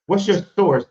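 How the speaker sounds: loud demand to stop the other debater and name a source; heated interruption. Real, not acted. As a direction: cut him off hard and demand an answer.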